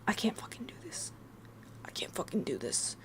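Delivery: barely audible desperate whisper; tiny defeated whisper, imitating own thoughts; whispered inner thought. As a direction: whisper it privately, at breaking point. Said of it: "this is me in my head".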